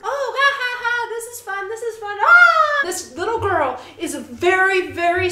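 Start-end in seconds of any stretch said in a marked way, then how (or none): 0.0-1.1 high, forced cartoon giggle; hammed-up giddy laughter; mock laugh. Mocking the girl's forced joy. 1.1-1.7 high, soft singsong "this is fun"; hammed-up forced glee; mock cheer. Mocking the girl's forced joy. 1.7-2.2 high, soft "this is fun" with weight on "is"; hammed-up strained glee; mock cheer again. Mocking the girl's forced joy. 2.2-2.8 high, loud cartoon scream; hammed-up shriek; mock shriek. Mocking the girl's breakdown.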